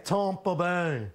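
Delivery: slow, stretched "Tampa Bay" with a snooty broad ah; Thurston Howell. Shown as a demonstration. Host showing how the phrase sounds, snooty millionaire drawl. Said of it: "Thurston Howell trying to say Tampa Bay".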